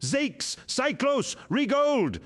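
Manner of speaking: high, loud battle yell of names. A narrator's reading. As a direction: call your men over the gunfire. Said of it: "Torgadon yelled".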